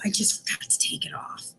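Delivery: a whispered aside, sheepish about the mask. Real, not acted. Librarian admits the forgotten mask to kids, whispering. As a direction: drop to a whisper to admit the slip.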